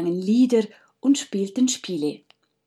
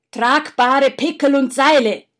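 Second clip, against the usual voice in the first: loud, higher barked order; commanding order; 5 semitones higher, 8 dB louder than usual. Acted. Faustus ordering his brothers to prepare, loud command. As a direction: order your brothers to get ready. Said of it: "befahl Faustus".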